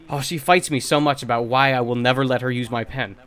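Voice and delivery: ordinary delivery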